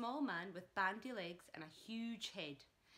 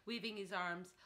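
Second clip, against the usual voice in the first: plain reading voice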